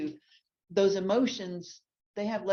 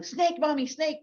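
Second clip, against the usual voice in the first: put-on high, excited toddler call; mock toddler yelling; child's yell; 9 semitones higher, 4 dB louder than usual. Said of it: "she hears me yelling".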